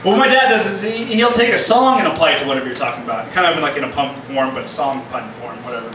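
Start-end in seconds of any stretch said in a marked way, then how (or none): none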